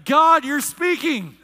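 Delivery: loud, high, slow cry to God; awed exclamation. Retold or imitated. Preacher reliving the call as his wife cries, exclaiming in awe.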